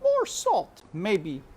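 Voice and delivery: high cook's voice, wondering aloud; cook musing. A narrator's reading. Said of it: "she said".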